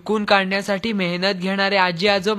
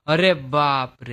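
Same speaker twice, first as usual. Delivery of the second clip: mild surprise; 6 semitones lower, slower than usual. Acted. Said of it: "हा आश्चर्योद्गार आहे".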